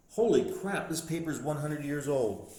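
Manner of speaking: astonished exclamation that settles as the sentence goes on; real amazed outburst. Real, not acted. Finds a hundred-year-old newspaper.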